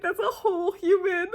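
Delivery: high, teary squeak of disbelief; half-cry the words like it's overwhelming. Retold or imitated. Fan marveling that the host's child grew up, teary and high. Fan retelling emotional reaction.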